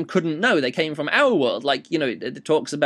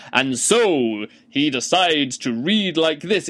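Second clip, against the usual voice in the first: slow, grand storybook read; epic fantasy narration; slower than usual. Shown as a demonstration. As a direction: read it like the opening of an epic saga. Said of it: "epic fantasy style".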